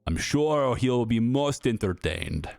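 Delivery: German official's voice in a hammed-up, uncertain accent; attempted German accent. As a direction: play a Nazi consul's aide with a stagey German accent. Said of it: "I have no idea what accent that is".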